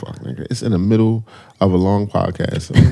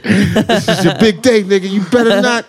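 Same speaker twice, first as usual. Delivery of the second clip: hype-man voice; 10 semitones higher than usual. Retold or imitated. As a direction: pump yourself up like a coach. Hyping himself up, retold.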